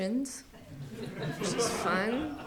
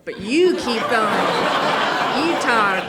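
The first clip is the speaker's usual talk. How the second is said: very loud, drawn-out therapist prompt; Tara, urging client on; 2 semitones higher, 10 dB louder than usual. Retold or imitated. Distracted therapist tells client to continue, loud exaggerated encouragement. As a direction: push the client to continue, big and slow. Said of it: "And then she'd go".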